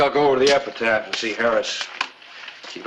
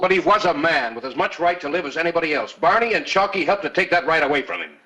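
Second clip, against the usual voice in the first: higher, driving argument; righteous, raised-pitch insistence; heated rebuttal; 7 semitones higher than usual. Acted. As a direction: argue back forcefully without a break. Marshal argues back in quarrel.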